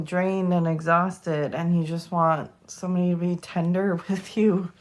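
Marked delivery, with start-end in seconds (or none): none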